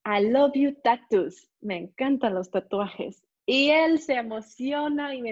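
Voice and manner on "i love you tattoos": slow, loud, exaggerated English; broken English compliment. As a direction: spell it out slowly to a foreigner. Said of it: "Y yo".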